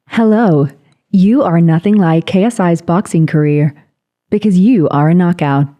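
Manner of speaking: stilted, measured robot-assistant delivery; Siri voice. Shown as a demonstration. Host asks for a cheesy chat-up line, Siri voice. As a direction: do a polite voice assistant. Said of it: "give us in the Siri voice".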